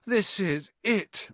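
slow, high despairing moan; wailing defeat. A narrator's reading. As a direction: draw it out hopelessly. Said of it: "Ron moaned".

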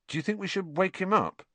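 high-pitched tentative question; mildly hesitant; awkward. A narrator's reading. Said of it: "Ron asked awkwardly".

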